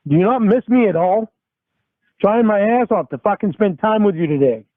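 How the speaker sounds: ordinary talk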